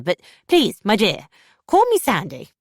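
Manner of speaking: honeyed host voice; sugary mother-in-law. Acted. Mother-in-law to the narrator, high syrupy insistence.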